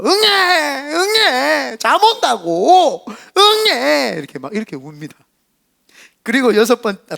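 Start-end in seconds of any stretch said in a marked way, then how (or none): 0.0-4.1 put-on higher, cranky baby wail; angry sleepy cry. Preacher imitating a sleepy baby crying angrily. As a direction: cry like a tired baby furious about needing sleep. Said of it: "화난 듯이 울어요".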